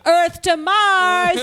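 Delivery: loud, slow, high; campy taunt. Acted. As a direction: drag it out mockingly. Mocking call to Mars.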